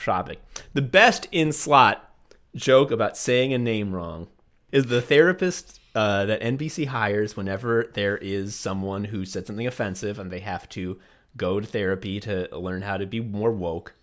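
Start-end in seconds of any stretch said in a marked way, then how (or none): none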